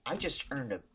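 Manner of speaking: ordinary delivery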